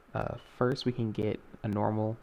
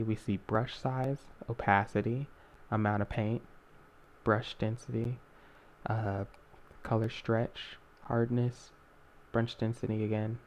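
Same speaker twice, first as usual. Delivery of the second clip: normal talking voice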